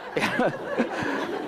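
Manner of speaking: high breathy laugh. Real, not acted. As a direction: laugh at your own quip. Laughs at own quip.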